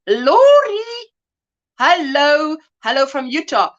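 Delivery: usual speaking voice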